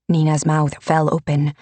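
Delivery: usual speaking voice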